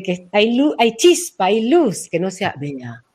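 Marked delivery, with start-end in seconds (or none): 2.1-3.0 dull Bea voice. Shown as a demonstration. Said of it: "la voz está opaca".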